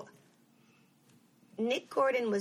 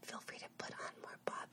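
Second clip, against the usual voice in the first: hushed request off to the side; whispered aside; 15 dB quieter than usual. Real, not acted. Aside to someone playing music.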